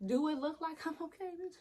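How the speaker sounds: mild mock outrage; indignant. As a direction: throw it back at the nurse. Angry retort to nurse.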